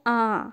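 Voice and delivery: आ hummed through nose and mouth together; nasal aa. Shown as a demonstration. Teacher shows children the nasal sound, a nasal आ. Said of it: "इस तरीके से बोला जाता है".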